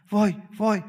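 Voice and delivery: high, loud imitated wailing; mock panicked wail. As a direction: ham up a panicky person crying out. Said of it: "دیدی بعضی اینجوری هن؟".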